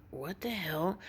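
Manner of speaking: very soft, baffled question; hushed, re-enacted incredulity; retold disbelief. Customer reacting to a worker's odd outburst, hushed disbelief.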